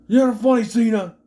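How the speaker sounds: high, loud scolding imitation; mock annoyed retort. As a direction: snap it back loudly.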